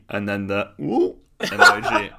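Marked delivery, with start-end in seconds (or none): 0.8-1.2 mock ooh. Voicing the emoji.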